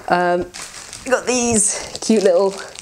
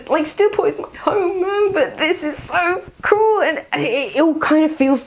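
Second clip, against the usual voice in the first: excited; 9 semitones higher than usual. Real, not acted. Excited about new game setting.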